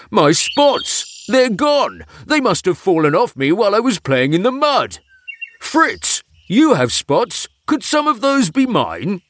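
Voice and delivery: high, panicky cries; anxious. A narrator's reading. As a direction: fret over the lost spots. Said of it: "he asked anxiously".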